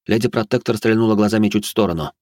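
plain reading voice

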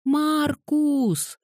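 high, long-drawn pitiful wail; unbearably pitiful little-girl call; plaintive call. A narrator's reading. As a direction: plead with the name in a whiny child's voice. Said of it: "невыносимо жалобным голосом позвала".

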